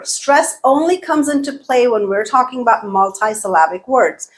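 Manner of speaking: usual speaking voice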